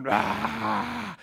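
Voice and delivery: loud mock bear "Rah!"; hammed-up grizzly roar.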